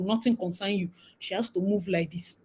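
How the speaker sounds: usual speaking voice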